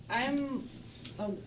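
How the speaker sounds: ordinary talk, nothing marked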